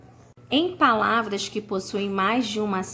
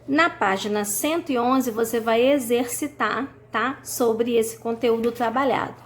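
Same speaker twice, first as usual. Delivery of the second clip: ordinary talk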